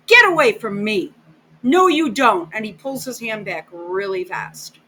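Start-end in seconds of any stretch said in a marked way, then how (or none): 0.1-2.5 loud, sharp imitation of her pulling away; mock angry snap. Bride to groom reaching for her hand, loud mock snap. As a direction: throw it off like a bride brushing him off, mock-offended. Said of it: "and she's like".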